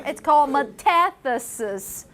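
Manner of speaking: usual speaking voice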